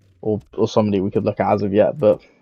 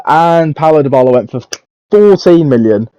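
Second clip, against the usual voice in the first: louder and higher, venting disbelief at the sale price; outraged exclamation; 5 semitones higher, 7 dB louder than usual. Real, not acted. Gamer ranting to viewers about a cheap sale, outraged and loud. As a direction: punch out the number like you've been robbed.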